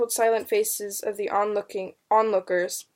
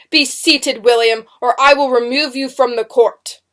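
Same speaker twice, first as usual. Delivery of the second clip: loud, threatening courtroom order; booming command; 3 semitones higher, 10 dB louder than usual. A narrator's reading. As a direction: boom out a warning from the bench. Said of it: "commanded in his booming voice".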